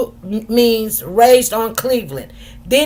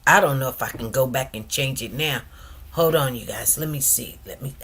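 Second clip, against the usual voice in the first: low, soft fretting under her breath; lower, softer worried mumbling; muttering to herself; 12 semitones lower, 10 dB quieter than usual. Real, not acted. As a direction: grumble quietly while fixing a mistake. Fretting over a typo live.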